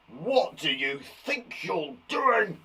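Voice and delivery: slow, stern mock-angry teacher. Teacher scolding pupils who misbehave, mock-angry. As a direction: scold the class like a cross teacher. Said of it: "That was, uh, an angry teacher".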